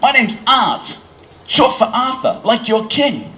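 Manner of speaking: proud American, high and loud; bad American accent. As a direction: introduce yourself in a hammy American voice. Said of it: "a terrible American accent".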